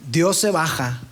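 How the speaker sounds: ordinary talk, nothing marked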